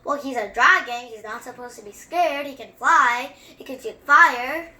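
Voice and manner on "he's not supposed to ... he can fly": ordinary delivery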